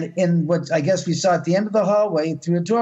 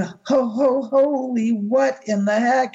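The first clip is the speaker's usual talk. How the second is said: high, stammering shock; stunned surprise; 7 semitones higher, slower than usual. Retold or imitated. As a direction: sputter out in disbelief. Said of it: "And I'm going".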